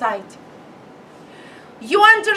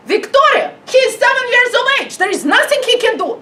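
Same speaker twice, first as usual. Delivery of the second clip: higher, louder, rushed complaint; exasperated parent; 8 semitones higher, 4 dB louder, faster than usual. Retold or imitated. Parent complains to Victoria about her son, exasperated. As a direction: rattle it off like a fed-up mum. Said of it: "we wake up and say".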